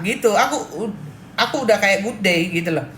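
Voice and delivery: ordinary delivery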